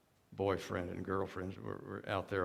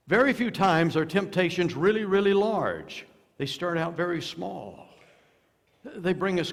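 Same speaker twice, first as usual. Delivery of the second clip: preaching at full force; 7 semitones higher, 9 dB louder than usual. Real, not acted. Preaching builds loud.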